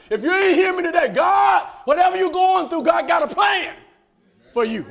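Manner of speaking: high, loud sermon cry; full-force preaching. Acted. Preacher promising God has a plan, high shouted preaching. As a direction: proclaim it to the whole church.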